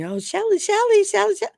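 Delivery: high, eager, sing-song calling of the name; gushing mock flattery. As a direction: ham up an overeager flatterer. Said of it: "compliment me and".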